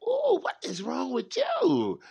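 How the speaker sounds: high, drawn-out incredulous reaction; hammed-up "what's got into you" voice; mock dismay. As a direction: stretch it out high, as if taken aback. Acted reaction to a cranky adult.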